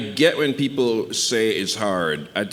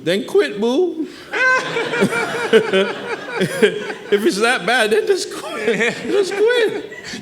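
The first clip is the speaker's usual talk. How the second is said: higher and louder, cracking up; laughing mockery; 4 dB louder, slower than usual. Real, not acted. Guest laughs as he mocks the Facebook complaint for the host. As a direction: laugh the retort right at the complainer.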